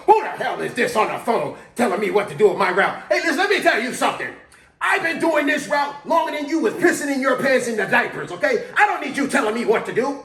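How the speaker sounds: loud, high, fast tirade with laughing edges; an old driver blasting a coworker over the two-way; furious old man rant. Retold or imitated. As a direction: tear into the young guy like a cranky veteran. Jerry rants over radio.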